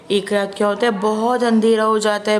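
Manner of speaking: plain reading voice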